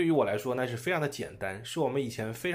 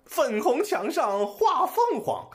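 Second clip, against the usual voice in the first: bright, high, joyful reading; happy; 10 semitones higher, 3 dB louder than usual. Shown as a demonstration. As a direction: read it like the holidays just started. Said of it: "读出四个月放假的快乐的那种感觉".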